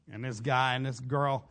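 normal talking voice

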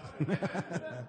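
quiet real chuckle; a small laugh to himself; soft chuckle. Real, not acted. Laughs at his own retort.

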